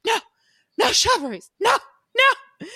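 high, loud shrieking refusal; woman shrieking no. Retold or imitated. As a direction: screech no at the knights. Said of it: "this woman's like".

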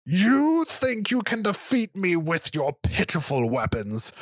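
high, slow scornful dragon. Acted. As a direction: sneer at the mortal warrior. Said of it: "the beast roared in pain".

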